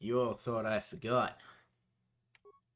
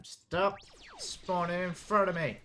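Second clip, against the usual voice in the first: higher, louder exasperated yell; frustrated shout; 6 semitones higher, 5 dB louder than usual. Real, not acted. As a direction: shout at the screen. Yelling at enemies in game.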